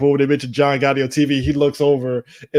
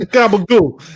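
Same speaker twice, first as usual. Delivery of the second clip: mock Italian; 5 semitones higher, 6 dB louder, slower than usual. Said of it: "how John Gotti Junior talk".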